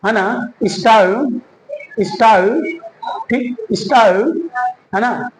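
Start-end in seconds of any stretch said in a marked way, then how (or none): 0.6-2.8 स्टाइल as "is-tau", rising, L concealed; hidden L demo. Shown as a demonstration. Teacher models style for learners, hidden L demo. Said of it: "तो कैसे बोलेंगे". 3.7-4.5 स्टाइल as "is-tau", rising, L concealed; hidden L demo. Shown as a demonstration. Teacher models style for learners, hidden L demo. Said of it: "तो कैसे बोलेंगे".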